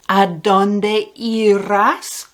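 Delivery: "¿A dónde irás?" asked slowly, rising on "A"; slow clear model. Shown as a demonstration. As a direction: spell the question out slowly for learners. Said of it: "Where will you go?".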